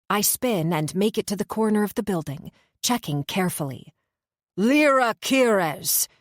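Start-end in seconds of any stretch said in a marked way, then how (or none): 4.6-6.1 slow, slurred, loud high call; Poseidon bellowing. A narrator's reading. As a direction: bellow her name across the dark. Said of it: "Poseidon bellows".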